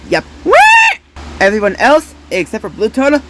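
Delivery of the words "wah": very high, loud shriek; shocked yelp. Acted. Toy shrieking at losing the prize. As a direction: yelp in dismay.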